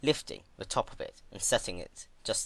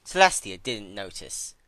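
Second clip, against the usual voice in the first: usual speaking voice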